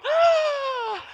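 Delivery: high, loud mock gasp; shocked gasp. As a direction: gasp like a whole scandalized congregation. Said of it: "All of us were going".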